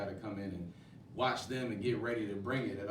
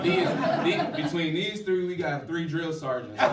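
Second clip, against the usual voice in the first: laughing loudly, higher, slowed near the end; 8 semitones higher, 12 dB louder than usual. Real, not acted. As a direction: laugh through the words, amused. Laughing about drill sergeant cast.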